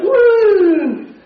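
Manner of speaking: high whooping cry into the water; a sudden high loud whoop of thrill; excited whoop. Retold or imitated. Stepping into the pool, a high excited whoop. First step into cold shallow.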